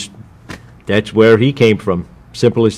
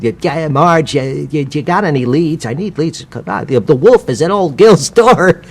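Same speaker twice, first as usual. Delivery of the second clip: a hint of a higher desperate salesman; lightly voiced nervous salesman forcing cheer; Gil voice; 5 semitones higher than usual. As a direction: salesman trying to sound happy but desperate, a bit higher. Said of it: "the Shelley the Machine character that Jack Lemmon played".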